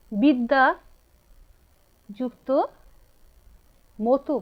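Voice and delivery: বিদ্যা, যুক্ত, মতু said as separate words with long pauses; spaced dictation. Shown as a demonstration. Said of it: "teacher dictating word by word".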